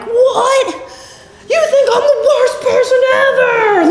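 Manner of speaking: high-pitched wailing overreaction; mock hysterics. Perfectionist overreacting to a mild suggestion, mock hysterical wail.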